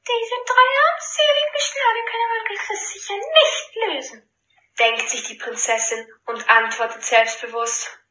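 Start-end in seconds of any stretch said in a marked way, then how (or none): 0.1-4.3 high, scornful princess; haughty princess voice. Acted. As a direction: sneer it as a spoiled princess. Princess thinks aloud. (hochmütige Prinzessin)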